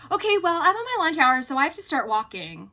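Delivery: curt brush-off. Retold or imitated. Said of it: "And she's like".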